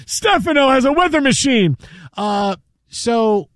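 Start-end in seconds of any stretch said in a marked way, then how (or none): 0.1-1.8 higher, hammed-up TV promo voice; mock soap announcer. Cohost mocking a daytime soap promo, hammed-up and high. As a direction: play it like a breathless soap opera teaser.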